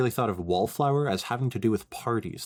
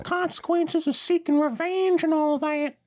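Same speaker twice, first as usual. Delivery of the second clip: put-on much higher, louder country girl voice; twangy farm girl, pitched way up; Applejack voice; 3 dB louder than usual. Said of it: "Applejack added".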